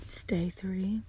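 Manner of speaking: slow soft whisper; hushed morning whisper. Real, not acted. Early morning whisper in camp.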